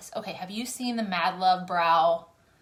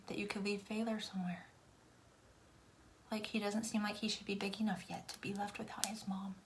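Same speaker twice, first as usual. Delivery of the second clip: soft whispered aside; 11 dB quieter than usual. Real, not acted. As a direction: drop to a whisper as if sharing a secret. Whispered aside about baby.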